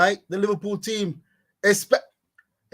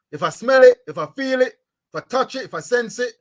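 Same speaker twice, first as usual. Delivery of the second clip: emphatic; 8 semitones higher, 7 dB louder than usual. Real, not acted. Building list, loud start.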